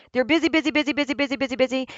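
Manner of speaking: mock-frantic busy chatter; frantic busyness. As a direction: rattle it off like someone rushing about. Mimicking people who keep busy.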